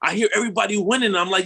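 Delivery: usual speaking voice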